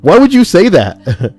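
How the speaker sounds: high, loud, drawn-out mock demand; hammed-up, slow and loud retort; mock indignant question. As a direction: stretch it out in exaggerated outrage. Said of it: "ask why she would say that".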